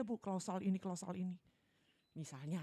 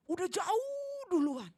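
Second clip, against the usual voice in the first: mocking; 11 semitones higher, 9 dB louder, much slower than usual.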